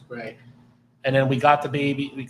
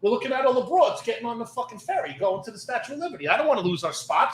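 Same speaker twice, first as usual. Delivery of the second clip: mocking the lazy partner; 9 semitones higher, 5 dB louder than usual. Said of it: "My partner's like".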